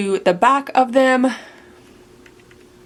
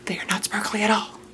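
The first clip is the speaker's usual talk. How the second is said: softer, quick, near-whispered aside; muttered self-correction; 7 dB quieter, faster than usual. Real, not acted.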